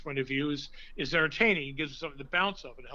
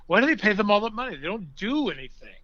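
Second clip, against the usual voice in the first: louder, higher, fast grumbling outburst; upset complaint; 5 semitones higher, 9 dB louder than usual. Retold or imitated. Stan Laurel complaining about TV actors, mock indignant. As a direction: rant like an indignant old comic. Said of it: "he goes".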